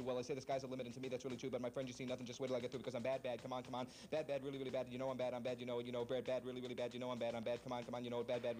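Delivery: spoken rush through the chorus, not sung; speed-talking a pop song on request; fast-talked song rendition. Shown as a demonstration. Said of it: "give us your rendition of it".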